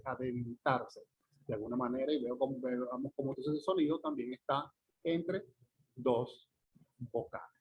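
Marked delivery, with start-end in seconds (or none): none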